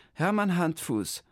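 high, chirpy clerk repeating the name; clerk echoing. Acted. The clerk repeats Hermann's name back, high and chirpy. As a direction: echo "Hermann Handfuß" high and bright as the clerk.

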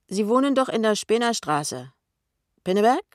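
high, loud needling question; prying colleague. A narrator's reading. Kessler probes Pinneberg.

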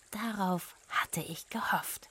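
slow whisper of a secret hope; hushed, pleased whisper; whispered aside. A narrator's reading. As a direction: whisper it as if nobody may hear.

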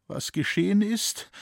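high, emotional echoed question; moved tone. A narrator's reading. Rosalie answers the man who found her, moved tone. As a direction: repeat the question with feeling, pitched high. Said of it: "antwortete Rosalie mit bewegtem Tone".

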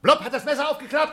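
high, loud alarmed outcry; alarmed cry. Acted. To the tape recorder, a high alarmed cry.

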